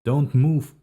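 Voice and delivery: high, loud screeching order; screeched command. A narrator's reading. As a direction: yell it sharp and high to freeze him. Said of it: "he screeches".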